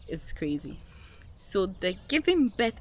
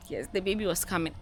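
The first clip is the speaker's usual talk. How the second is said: usual speaking voice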